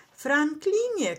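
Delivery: high, slow, drawn-out call; mild shout to get attention; insistent cry. A narrator's reading. Skunk desperate to be heard, insistent cry. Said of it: "krzyknął z całych sił skunks".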